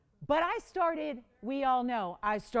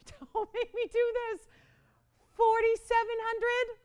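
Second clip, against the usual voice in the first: high, louder, laughing whine; whiny mock plea; 11 semitones higher, 6 dB louder than usual. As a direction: beg like a playful child, pitch way up. Repeats begging for lower price.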